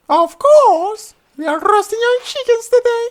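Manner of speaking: very high, loud sarcastic princess; sharp princess. Acted. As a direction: cut him down. Princess retorts.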